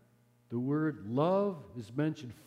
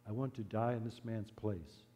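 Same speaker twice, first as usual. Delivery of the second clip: much softer, low and gentle; spoken softly; 4 semitones lower, 8 dB quieter than usual. Acted. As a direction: offer your life quietly and calmly. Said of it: "The priest spoke softly".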